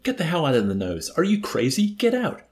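high, incredulous shout building as it goes; acted scream of tortured disbelief; screamed amazement. A narrator's reading. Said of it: "screamed at Arfie in tortured amazement".

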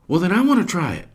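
put-on quick, high, eager kid voice; little boy piping in. Hops interrupting to his mother, fast and high. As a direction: jump in before anyone stops you. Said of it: "Hops pipes in".

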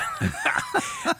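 high giggling laugh; nervous laugh. Real, not acted. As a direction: burst out in a sheepish laugh. Nervous laugh recalling meeting his wife.

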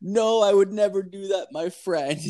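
high-pitched mock protest of loyalty; earnest scam caller. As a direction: imitate a caller swearing he never would. Voices the scammer's earnest denial.

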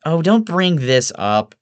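high, drawn-out whine; whining. A narrator's reading. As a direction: whine in protest, slowly. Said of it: "Evelyn whines".

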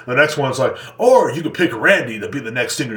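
ordinary delivery

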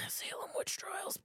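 slow, hushed accusation; mock ominous whisper. Joking with co-host about Salem, mock ominous whisper. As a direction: whisper it like a dark warning.

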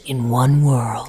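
low, drawn-out trailer announcer; movie trailer voice. Acted. Imitating male movie trailer voice.

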